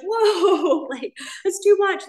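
high, laughing whoa; laughing overwhelm. Retold or imitated. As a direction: laugh off the pressure, a bit overwhelmed. Overwhelmed by newcomer offers.

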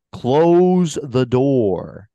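loud, very slow, over-enunciated command; Alec Baldwin. Retold or imitated. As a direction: Close the door drawn right out, mouth rounding each word. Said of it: "is so enunciated in the way he speaks".

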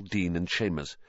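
ordinary delivery, nothing marked

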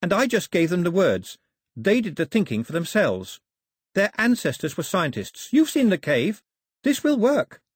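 put-on high, insistent girl's voice; excited daughter, rising in force; earnest daughter.

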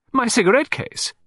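high-pitched exclamation of surprise; startled cry. Acted. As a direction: cry out in astonishment, pitch shooting up. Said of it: "cried Mister Hardman".

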